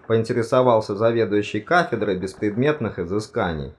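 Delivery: ordinary delivery, nothing marked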